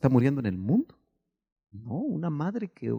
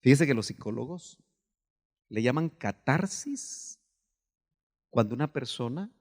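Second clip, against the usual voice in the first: ordinary delivery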